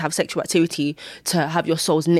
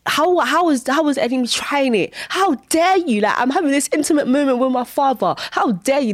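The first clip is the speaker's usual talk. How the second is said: high indignant how dare you; outraged; 8 semitones higher than usual. Retold or imitated. Retelling her outrage at the enemy, high and indignant. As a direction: protest shrilly at the enemy's nerve.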